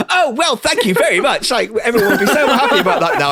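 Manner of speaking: high, hammed-up cheery thanks; mock sudden happiness. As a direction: switch to gushing gratitude, high-pitched. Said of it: "I took a tonal shift".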